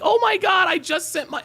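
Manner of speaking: high, loud mock-excited friend; excited squeal. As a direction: squeal it like big news just landed. Excited friend quoted on speakerphone.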